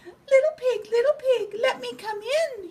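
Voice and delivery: very high, sweet fake piggy voice; disguised javelina voice. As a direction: coyote faking a little pig, sugary. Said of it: "make his voice sound just like another javelina's".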